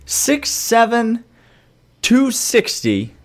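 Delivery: high, slow, emphatic indignation; indignant hype. Pretend. Host arguing for his player, indignant hype. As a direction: spell out each credential slowly, pitched high, incredulous.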